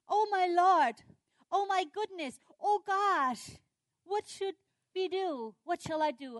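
loud, high wails of alarm; panicked outcry. Acted. Servant seeing the army all around, acted panic. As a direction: cry out in hammed-up dismay.

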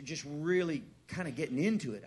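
ordinary talk, nothing marked

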